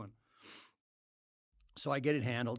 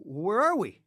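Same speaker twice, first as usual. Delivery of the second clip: higher, louder baffled question; alarmed question; 7 semitones higher, 9 dB louder, slower than usual. Retold or imitated. Speaker to friend on learning the town is wrong, alarmed. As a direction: blurt it out, suddenly thrown. Acted confused reply to friend.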